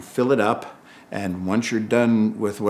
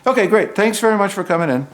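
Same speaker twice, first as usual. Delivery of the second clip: brisk dismissal; 9 semitones higher, faster than usual. Retold or imitated. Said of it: "retold casting dismissal".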